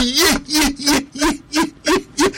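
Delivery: high, hammed-up wailing ye ye; mock wail. Retelling the scene, hammed-up high wail.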